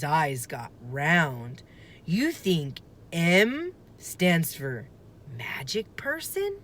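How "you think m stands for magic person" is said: slow, wide-eyed question; drawing out a wondrous idea; slow wonder. Acted. Annie wide-eyed about the medallion, slow wonder.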